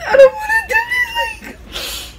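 panicked crying protest; scared whine. Retold or imitated. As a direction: wail it like a frightened kid. Quoting herself refusing, scared.

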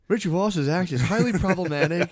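mock earnest journalist. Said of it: "you're like".

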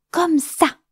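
high, loud burst of discovery; excited exclaim. Acted. Cricket spots the bird's feather and exclaims, excited. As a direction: cry out as the idea hits. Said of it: "s'exclama-t-il".

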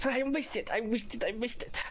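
high-pitched frustrated whine. Real, not acted. Frustrated gamer misses shot.

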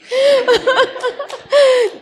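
a high, delighted laugh burst; gleeful laugh. Real, not acted. Wife laughing at her own revenge fantasy, high and delighted.

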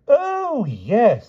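high, swooping realisation; dotty king remembering. A narrator's reading. King recalls the beast, swooping high. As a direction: let the memory dawn aloud.

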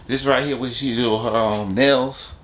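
ordinary talk

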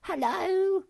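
high-pitched monkey calling out loudly; excited shout. Acted. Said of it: "she shouted".

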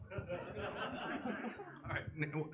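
ordinary talk

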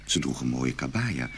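usual speaking voice

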